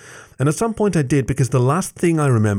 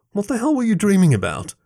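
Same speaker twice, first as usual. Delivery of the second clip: high-pitched agitated question; exasperated demand; 12 semitones higher than usual. A narrator's reading. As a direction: demand an answer, starting high and falling. Worried friend demands an explanation.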